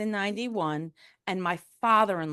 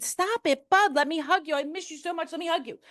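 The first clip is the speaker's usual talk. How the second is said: high-pitched acted plea; pleading; 10 semitones higher than usual. Begging her cousin for a hug, pleading.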